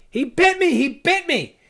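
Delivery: very high, yelled repeated outcry; frantic high-pitched yelling; distressed exclamation. Acted. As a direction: cry out in acted distress. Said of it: "She's obviously distressed by these attacks".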